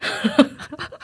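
a short voiceless giggle; breathy giggle. Real, not acted. Guest giggling at her own bold answer, voiceless giggle. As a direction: giggle under your breath.

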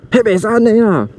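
shouting at the uncle pouring soup back; mild retold shout. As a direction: call out to stop him, half-voiced. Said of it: "我是直觉的喊出来说".

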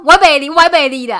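very loud mock Taiwanese yell, laughing through it; hammed-up Taiwanese shout from the shopkeeper; imitating a yelling shopkeeper. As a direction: yell it like a cranky old shopkeeper while laughing. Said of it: "他就说".

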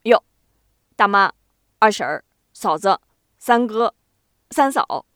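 响亮地一个个叫人; 赶忙挨个招呼赔礼，略带油滑; 油嘴滑舌赔不是. A narrator's reading. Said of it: "油嘴滑舌的赶忙赔不是". (油腔滑调地赔不是)

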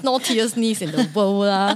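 normal talking voice

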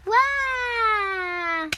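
long, loud, high wow; mock amazement. Suneo marvels at Gian's pig act, mock amazed. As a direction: let a big wow slide slowly down.

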